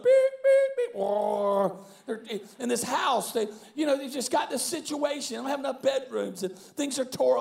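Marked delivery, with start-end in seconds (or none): 0.0-1.8 bulldozer noises. Retold or imitated. Imitating contractors' bulldozers arriving, beeps and roar. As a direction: imitate a reversing bulldozer and its engine. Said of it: "it's like contractors and bulldozers".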